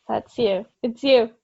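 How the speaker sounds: usual speaking voice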